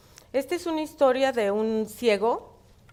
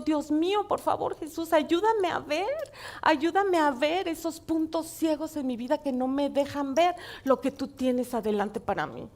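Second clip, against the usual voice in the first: prayer; 3 semitones higher than usual. Pretend. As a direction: pray it to Jesus, asking to see. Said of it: "Yo digo".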